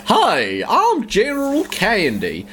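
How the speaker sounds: slow, hammed-up Texas drawl; exaggerated Texan introduction; Texas accent. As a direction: play up a silly Texas voice. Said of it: "Arnold Schwarzenegger doing a Texas accent".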